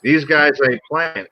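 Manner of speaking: ordinary talk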